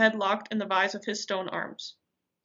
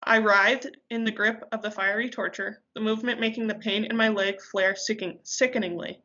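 ordinary delivery, nothing marked

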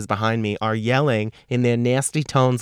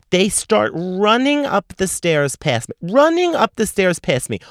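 can't believe they're doing this; mock-scandalised, pitched up high; outraged; 8 semitones higher, 3 dB louder than usual. Real, not acted. Outraged at line-jumpers.

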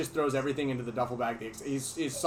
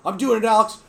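loud Mikey quote; 7 semitones higher, 10 dB louder than usual. Retold or imitated. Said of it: "Mikey's like".

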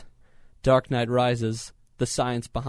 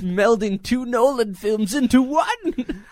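laughing; 9 semitones higher, 6 dB louder, slower than usual. Real, not acted. Laughing commentary, high and slow.

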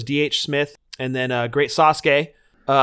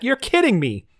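higher-pitched mock astonishment; retold disbelief; 7 semitones higher than usual. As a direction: say it as if you can't believe it. Retold disbelief at surprising news.

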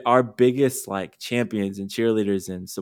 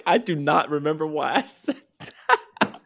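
laughing at herself; 7 semitones higher than usual. Real, not acted. Laughs at forgetting her point.

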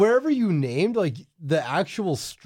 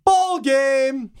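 shouted catchphrase; 8 semitones higher, 4 dB louder than usual. Retold or imitated. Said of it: "J B L will be like".

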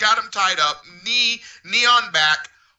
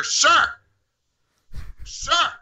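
high-pitched call after someone; imitating an insistent employee; imitated staffer calling; 8 semitones higher than usual. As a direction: call out to stop a customer. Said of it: "and I hear".

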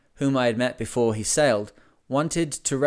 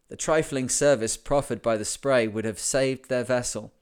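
plain reading voice

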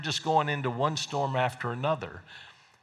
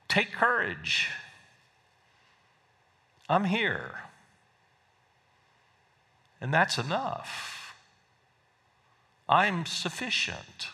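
gentle reassurance; 5 semitones higher, 3 dB quieter, slower than usual. Acted. Jesus speaks to the troubled listener.

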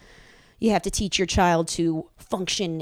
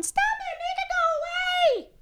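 put-on high, whiny little boy yelling; child shrieking.

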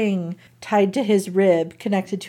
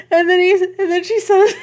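higher, choked laugh-cry as she says it; laughing, near tears; 10 semitones higher than usual. Real, not acted. Telling her cohost the sad part, laughing through it.

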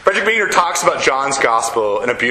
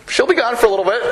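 put-on breezy, much higher dad voice fobbing off the kids; dad stalling his kids; 9 semitones higher, faster than usual. Dad telling kids mom will be back, breezy and high. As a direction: sing it out lightly to dodge the question. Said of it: "That's what I say to my kids".

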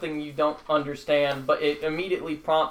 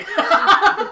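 high laugh; 10 dB louder than usual. Real, not acted. Laughing at own goblin yelp.

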